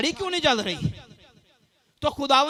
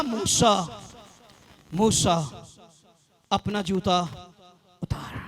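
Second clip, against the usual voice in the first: God calling Moses; 8 semitones lower, slower than usual. Acted. God calls Moses at the burning bush, solemn low voice. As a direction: call the name low and hushed, with pauses. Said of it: "तो खुदा ने क्या कहा?".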